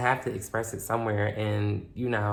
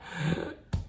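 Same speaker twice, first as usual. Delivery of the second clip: a sharp, high gasp for air; imitated gasp; 8 semitones higher, 4 dB quieter than usual. Mimicking her boyfriend's desperate gasp for breath. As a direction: suck in air like choking.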